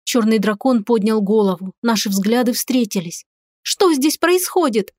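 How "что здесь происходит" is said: high, slow alarmed cry. A narrator's reading.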